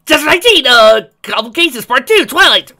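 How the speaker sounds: high, loud title call; loud announcement. Acted. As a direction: announce the chapter loudly.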